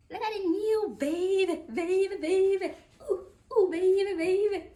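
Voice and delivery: high, loud, sing-song delight; excited cooing. Real, not acted. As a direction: show it off with high, gleeful repeats. Showing off the new baby.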